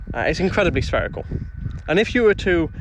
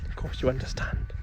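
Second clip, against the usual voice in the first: a breathy, conspiratorial whisper; 3 semitones lower, 9 dB quieter than usual. Pretend. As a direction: whisper it like a secret. Mock confidential aside to chat.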